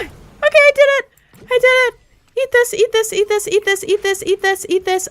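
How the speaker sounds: very high, frantic excited voice; excited squeal. Real, not acted. Streamer after winning a gunfight, excited high squeal. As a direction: squeal it high, rushing with adrenaline.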